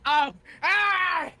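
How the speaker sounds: startled yelp; sudden surprised cry; surprised shout. Real, not acted.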